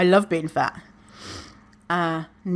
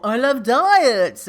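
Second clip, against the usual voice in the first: mocking idiot; 4 semitones higher, slower than usual. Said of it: "idiots who are like".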